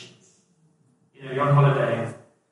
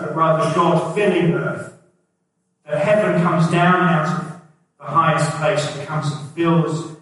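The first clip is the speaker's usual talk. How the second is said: louder, building sermon push; fervent preaching; 5 dB louder than usual. Acted. Pastor to congregation, preaching with rising force. Preaching the heaven-comes-down point.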